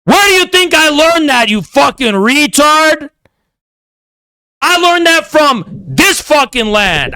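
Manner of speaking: heated rant. Real, not acted. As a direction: rail at them with rising anger. Angry rant at critics.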